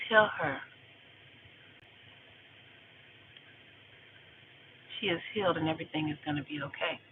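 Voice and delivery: softly imitating a near-whisper; gently voiced inner message; small still voice. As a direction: give it a quiet, measured start. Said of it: "It was small. It was a still voice, like a whisper".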